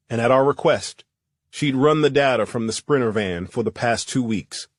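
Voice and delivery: plain reading voice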